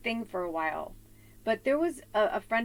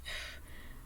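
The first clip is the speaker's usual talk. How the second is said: long breathy exhale; quiet, weary puff of breath; exasperated sigh; 16 dB quieter than usual. Retold or imitated. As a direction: blow the air out softly. Exasperated reaction to hostile man.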